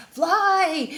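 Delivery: high, loud shouted command. Acted. The fleeing bear shouting to the bluebirds, loud and high. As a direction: yell it up to the birds. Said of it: "he shouted to the bluebirds".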